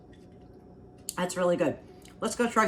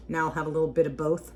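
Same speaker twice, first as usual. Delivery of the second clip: ordinary talk, nothing marked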